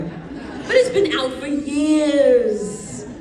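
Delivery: loud, drawn-out playful whine; mock protest. Actress jokingly defends a spoiler to the audience, mock protest. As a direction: stretch it out in exaggerated, laughing exasperation.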